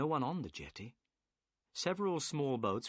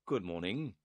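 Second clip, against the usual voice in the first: ordinary delivery, nothing marked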